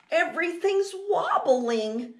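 put-on high, alarmed child's voice; urgent warning. Little mouse warning Mom about the wobbling, urgent cry.

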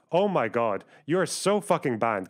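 ordinary talk, nothing marked

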